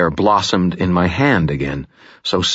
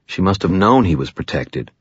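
ordinary delivery

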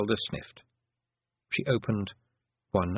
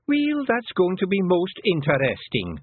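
sharp; 10 semitones higher, 4 dB louder, slower than usual. A narrator's reading. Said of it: "said Fionn sharply".

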